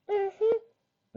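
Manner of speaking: very high, small filly hum; little filly. A narrator's reading.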